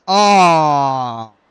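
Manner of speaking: loud, drawn-out disappointed aw. Real, not acted. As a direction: groan of playful letdown. Reacting to chat comment.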